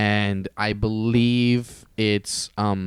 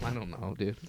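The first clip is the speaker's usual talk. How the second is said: ordinary talk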